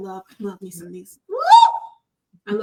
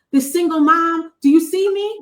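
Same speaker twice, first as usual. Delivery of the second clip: pleading single mom; 5 semitones higher, 5 dB louder than usual. Retold or imitated. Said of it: "we do that as women a lot".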